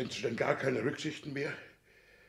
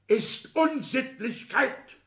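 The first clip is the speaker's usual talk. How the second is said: much higher, very slow, pronounced; indignant verdict; 11 semitones higher, 6 dB louder, much slower than usual. Acted. Father condemning son's proposal, slow indignant verdict. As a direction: spell out the verdict with outrage.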